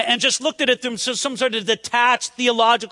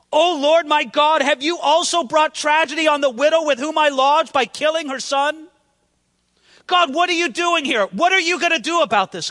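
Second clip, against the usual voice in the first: raised, urgent plea to God; strong-worded prayer; 5 semitones higher, 3 dB louder than usual. Acted. Elijah questioning God over the widow's son, crying out urgently. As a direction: cry out higher and louder, demanding an answer. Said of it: "he prayed very strong words".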